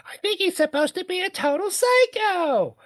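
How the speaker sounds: put-on high, breathy gossipy girl voice; gossiping teen girl. High school gossiper whispering about Buddy in a high girl voice. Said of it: "you know".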